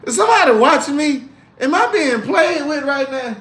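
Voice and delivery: high, exasperated outrage; retold outrage. Retelling his reaction to the price jump, high exasperated outrage. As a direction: throw the questions up high, fed up. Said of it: "I was like".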